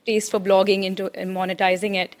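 ordinary talk, nothing marked

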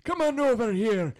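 high, hammed-up old cartoon grandpa; animated old man's accented voice; Stu's dad from Rugrats. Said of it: "Stu's dad in, uh, in Rugrats".

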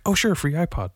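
mock casual acceptance; imitated neighbor. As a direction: pitch it up as the opportunist. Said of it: "he was like".

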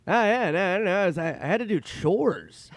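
Norm impression. Norm greeting his buddy Nicky on the phone, hammed-up. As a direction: gush like an old pal who just remembered. Norm's excuse.

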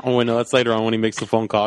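normal talking voice

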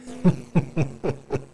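sinister laugh behind the mask; a cold laugh from under the mask; masked laughter. Acted. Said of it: "you hear laughter from under the mask".

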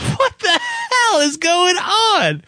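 high, drawn-out cry of disbelief; exasperated, incredulous high howl; astonished outburst. Real, not acted. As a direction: wail it in comic disbelief, stretching the words. Co-host reacts astonished to the story.

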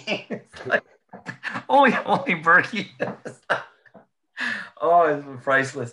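helpless delighted laughter; laughing. Real, not acted. Summing up Bergie to the hosts, laughing high and loud.

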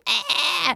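high hype squeal; excited shriek. Real, not acted. Host hyping her return to the city, high excited shriek. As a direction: let out a thrilled "ah".

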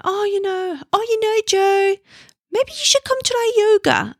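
high-pitched, hammed-up South African accent; bad South African accent. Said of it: "just my bad South African accent".

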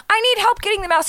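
high, loud retold pleading; frantic plea. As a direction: re-enact begging a husband for help.

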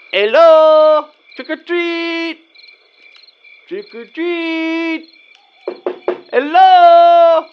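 loud, high sing-song call, drawn out slowly; calling at the door. Acted. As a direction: stretch each word out like calling up to a window.

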